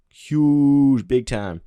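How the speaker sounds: big loud burst on huge; loud exclamation. Real, not acted. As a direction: blurt it out loud. Excited reaction to speedrun nerf.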